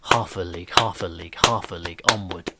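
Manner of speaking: "Half a league" chanted with a heavy beat on "Half"; beating out the rhythm. Shown as a demonstration. Teacher showing the galloping meter, beat heavy on "Half". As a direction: thump "Half" and let "a league" fall away. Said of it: "you can hear almost a kind of a beat".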